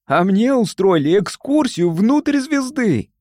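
high, slow, drawn-out boast; boastful. A narrator's reading. Фима bragging about his star excursion, boastful. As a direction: show off, stretching the words. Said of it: "похвастался Фима".

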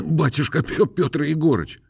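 high, pleading peasant voice. A narrator's reading. Khromoy pleading. (высокий просящий голос мужика)

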